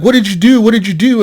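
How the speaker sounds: high-pitched imitated wife; mock frantic questioning without real sobs; freaked-out crying. Terrorist's wife questioning her husband, mock freaked-out crying. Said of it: "freaking out, crying".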